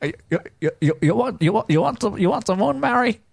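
put-on stammering, halting old-movie hero; film character impression. Co-host hijacks movie chat with a film speech, stammering impression. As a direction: stutter through a halting romantic film speech.

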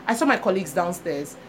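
ordinary talk, nothing marked

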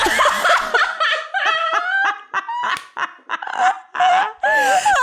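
long high cackling laugh; high burst of laughter. Real, not acted. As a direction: crack up high and hard. Mom's balloon story punchline.

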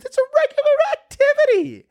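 very high, loud squeak; squeaky voice. Acted. Comic insisting to viewers bat-hanging is regular, loud squeak. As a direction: squeal it out sarcastically.

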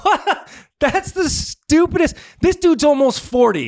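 high, loud disbelief; real laughing incredulity; incredulous. Real, not acted.

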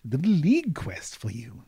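a bubbly patron explaining herself; cheerful noblewoman Delilah. Acted. Delilah NPC explaining the quest.

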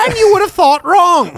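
high Jordan Peterson voice; Peterson impression. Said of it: "you can do a good Peterson".

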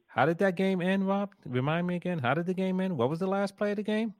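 rising, teasing feigned ignorance; mock innocent question. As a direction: pretend not to know, high and playful. Mock asking, knowing the answer.

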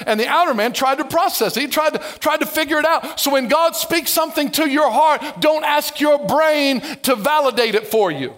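usual speaking voice

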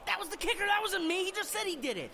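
high-pitched protest; indignant denial. Acted. Quarterback denies stealing punch, high indignant. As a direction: squeak out the denial. Denying accusation.

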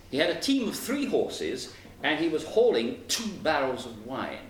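plain reading voice

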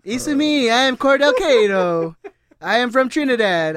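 drawn-out mock Italian accent; hammed-up Italian, slow on the name; Mario-style Italian accent. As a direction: introduce yourself as a cartoon Italian plumber. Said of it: "It's Italian".

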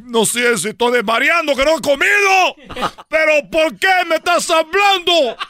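high, slowed, rising to a hammed-up shout; mock panic. Son startled when his dead father answers, mock panicked shout. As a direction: freak out as the voice answers.

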